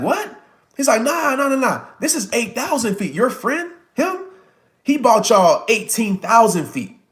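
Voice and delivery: high, light incredulous voice; acted disbelief. Instructor correcting a nervous tandem jumper, high incredulous protest. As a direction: imitate a baffled instructor, pitched well up.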